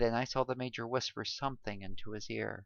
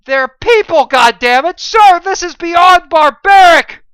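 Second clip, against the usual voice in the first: high, very loud enraged shout; furious outburst; 26 dB louder than usual. A narrator's reading. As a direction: rage at the captain's cruelty. John outraged at captain.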